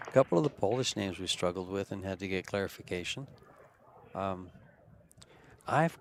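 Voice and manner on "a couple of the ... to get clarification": usual speaking voice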